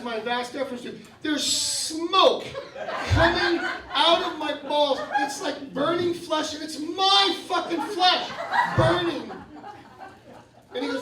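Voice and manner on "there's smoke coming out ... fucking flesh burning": loud, high, outraged exclaiming; comic horror. Retold or imitated. Patient telling of his own flesh burning, comic horrified outburst. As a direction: build the horror, punching each phrase louder and higher. Horrified climax of surgery story.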